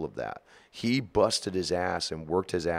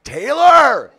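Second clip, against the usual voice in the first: mock yelp, much higher and louder; startled shriek; 13 dB louder than usual. Startled bandmate shrieks at Taylor sneaking up, loud mock yelp. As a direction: shriek his name, startled. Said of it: "and he just".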